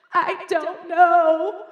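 loud, very drawn-out mock sob; wailing crying child. Eight-year-old answering her mother, hammed-up tearful wail. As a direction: wail each word slowly like a sobbing kid. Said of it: "she's sitting in her room crying".

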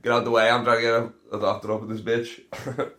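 normal talking voice

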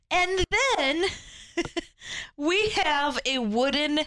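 rising, slow disbelief at the reveal; drawn-out incredulous laugh. Real, not acted. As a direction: let the absurdity land slowly. Stunned reveal of absurd prop.

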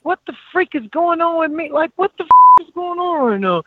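high-pitched, distraught reenactment of crying; retold tearful outburst. Athlete crying while stretching after practice, mock tearful outburst. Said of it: "I start crying".

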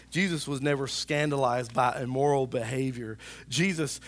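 ordinary talk

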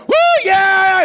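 loud, high whoop of a cheering fan; mock fan cheer. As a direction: cheer like the touchdown just landed. Fan cheering at football game.

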